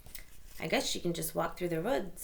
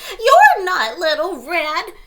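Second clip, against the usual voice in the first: loud, high alarmed shout; 12 semitones higher, 17 dB louder than usual. Acted. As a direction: yell it in shock. Granny yells at wolf.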